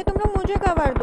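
urgent shout for cover. Real, not acted. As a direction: yell it at the squad. Under fire, calls for cover.

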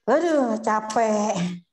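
slow drawn-out tired sigh; weary sigh. Retold or imitated. A preacher voicing a worn-out mother, slow and tired. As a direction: let the tiredness pull the words out slowly.

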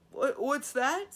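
put-on old dragon straining to hear; hard-of-hearing old dragon. A narrator's reading. Old dragon asking Simrine what she said, hard of hearing. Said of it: "old and rather hard of hearing".

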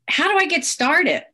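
normal talking voice

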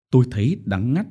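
ordinary delivery, nothing marked

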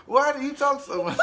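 asked through laughter; giggling question; laughing. Real, not acted. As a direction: tease them while cracking up. Teasing friend, laughing.

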